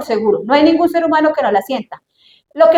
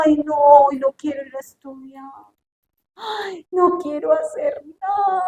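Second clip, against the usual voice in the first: drawn-out whiny complaint, slower at the end; whiny teen; 2 semitones higher, 4 dB quieter than usual. Acted. Hijo que no quiere estudiar. (quejido de adolescente perezoso)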